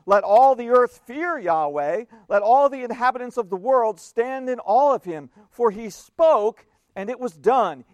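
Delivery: loud, proclaiming psalm read; scripture reading. Acted. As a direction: declare the psalm to the congregation. Reads Psalm with preaching force.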